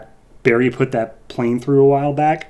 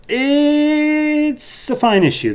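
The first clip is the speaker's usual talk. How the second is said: much higher, drawn-out, put-on voice; mock-grand verdict; 3 dB louder, much slower than usual. Reviewer giving his verdict on the issue, in a high mock-grand voice. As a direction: stretch it out like a sarcastic pronouncement.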